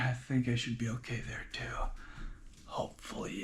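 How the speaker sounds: breathy murmur to himself while arranging gear; whisper. Real, not acted. Lifter whispering to himself while lining up his gear. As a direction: whisper it under your breath. Checking setup, talking to self.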